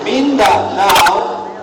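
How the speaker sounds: "Mindanao" drawn out slowly, weight on NAO; stress on NAO. Shown as a demonstration. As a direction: stretch "Mindanao" and stress its last part, NAO. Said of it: "I will emphasize now".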